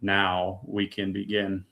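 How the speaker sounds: slow, quiet voice of God; understated, unhurried divine reply; God's response. Retold or imitated. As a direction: answer softly and slowly, with pauses. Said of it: "the response was".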